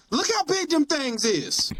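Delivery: high, slow Southern country twang; country accent. Retold or imitated. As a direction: say it with a thick country drawl. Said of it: "I say without hesitation".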